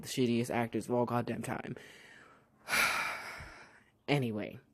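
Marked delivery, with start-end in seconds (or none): 2.7-3.5 long breathy sigh; heavy exhale, exasperated. Real, not acted. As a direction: let out a fed-up sigh. Fed up with the bad acting.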